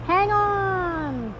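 high, drawn-out call; yelled warning. A narrator's reading. Barnaby warns his passengers, high yelled call. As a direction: yell it out long and high. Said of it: "yelled Barnaby".

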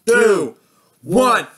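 loud, evenly spaced countdown; countdown call. Real, not acted. Host counting down to New Year, loud call. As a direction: call each number out for a crowd.

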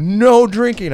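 high, very loud order; imitated stern warning. Hospital staff warn departing patient, loud mock warning. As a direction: boom out the rule in mock sternness.

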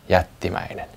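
understated hushed gasp; awed gasp. A narrator's reading. Molli finds a giant footprint, softly gasped. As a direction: softly breathe it out in awe. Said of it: "se henkäisi".

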